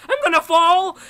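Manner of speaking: high, very loud mock-panic shout; imitating flipping out. Girl facing us on the ladder, imitated flipping out. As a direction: yell it like someone terrified of falling. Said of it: "she was just flipping out".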